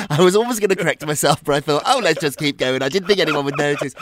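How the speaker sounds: loud, fast laughing self-defence; excited. Real, not acted. Host explaining his Monday slip, loud and fast. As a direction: rush the excuse out loud and fast.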